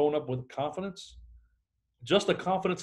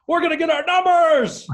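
mock-eager salesman, much higher and louder; imitating a pumped-up cold caller, high and loud; pumped-up excitement; 12 semitones higher, 8 dB louder than usual. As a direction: hype yourself up like a Pollyanna rep. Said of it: "Pollyanna and excited".

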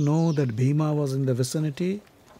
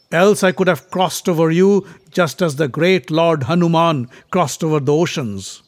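proud prince; 4 semitones higher, 6 dB louder than usual. Acted. Bhima lectures the monkey.

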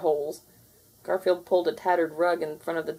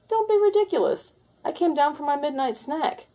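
scoffing ghost; 10 semitones higher than usual. Acted.

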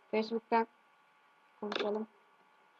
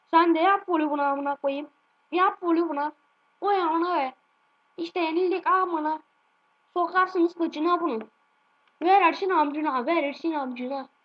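louder, slowed frustrated yelling; angry shouting at the game; 4 semitones higher, 5 dB louder than usual. Real, not acted. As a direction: spit out each word in defeat. Losing match, shouting.

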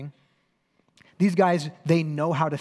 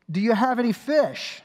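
calling out; 8 semitones higher, 5 dB louder than usual. Acted. Jesus calling from shore.

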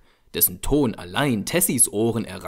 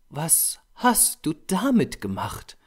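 higher, slow exclamation in a woman's voice; exclaimed; 7 semitones higher than usual. A narrator's reading. Model seeing the ruined painting, mildly exclaimed. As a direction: cry out in character, drawn out without extra volume. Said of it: "rief sie laut aus".

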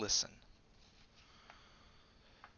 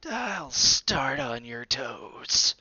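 very slow, loud gloating threat; very slow loud gleeful threat; slow menace; 3 semitones higher, 7 dB louder, much slower than usual. A narrator's reading. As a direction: savour each word of the threat. Dot threatens bear.

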